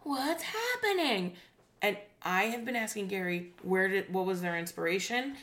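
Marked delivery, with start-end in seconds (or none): 0.0-1.4 high, slow mock kids' show question; exaggerated sing-song wonder; YouTube kid voice. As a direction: stretch it out like a hammed-up child presenter. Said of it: "And everybody's like".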